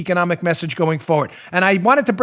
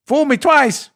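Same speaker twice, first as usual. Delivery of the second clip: a hint of Bush, higher and laughing; mock Bush; 7 semitones higher, 4 dB louder, slower than usual. Host mocking Bush's famous flub for listeners, lightly voiced. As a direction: lightly imitate Bush fumbling the line. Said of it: "Bush could never get that right".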